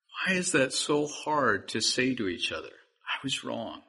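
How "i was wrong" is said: soft confession. Shown as a demonstration. Preacher showing the congregation a soft admission. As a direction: own up gently, hushed. Demonstrating the phrase again.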